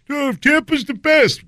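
high, slow mocking whine; mock the know-it-all fan in a high drawn-out voice. Mocking ignorant locals.

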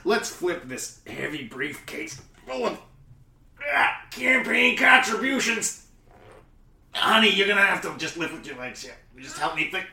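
loud, high, strained heave; straining effort. Acted. Hauling a heavy briefcase with partner, loud straining. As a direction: grunt it out like lifting something heavy.

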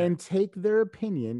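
high, loud, insistent imitation; emphatic advice. Friend telling him whose opinion to take, insistent. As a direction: drive the advice home as the friend did.

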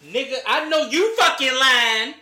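loud, high disbelieving shout; shocked yell. Real, not acted. Shocked reaction to bill question.

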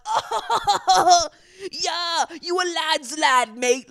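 high, loud laughing British lad voice; mock laughing lads. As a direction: cackle along like a cheering mate. Mocking boys laughing along.

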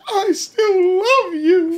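slow, very high mock-tearful wail; really high-pitched crying. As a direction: imitate a man crying, stretching it out high. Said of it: "he goes really high pitch".

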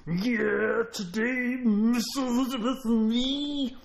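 high, shaky gravelly wrestler voice, slowing down; Randy Savage. Retold or imitated. Impersonating the wrestler talking about his valet, slow gravelly voice. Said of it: "this gravelly voice".

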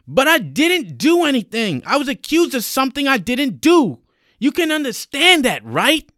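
high, loud pleading; desperate protest. Acted. Accused young man begging sergeant to understand, desperate loud protest. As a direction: plead your innocence at full pitch.

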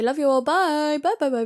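normal talking voice

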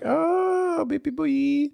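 very high-pitched teasing coo; mock high cooing. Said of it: "You can be like".

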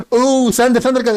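high, hammed-up English cartoon dub; mocking how the English dub says Thunder. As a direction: parody the English narrator shouting Thunder. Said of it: "τον Άγγλο να μου λέει".

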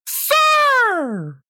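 a high, shrill-pitched shout of "Sir!"; mock yell. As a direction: call out like an outraged lady across a parking lot. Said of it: "yelling at me".

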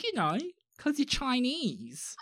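a light parody of a clueless acquaintance; imitating the woman. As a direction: mimic her slow patronising tone. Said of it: "she sounded exactly like this".

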